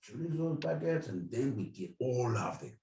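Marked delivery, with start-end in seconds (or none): 0.0-1.1 mock sly greeting. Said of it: "We're like".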